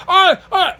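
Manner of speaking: short, loud high-pitched yells; imitated screaming. Retelling the street argument, imitated screaming. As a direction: let out two sharp mock screams.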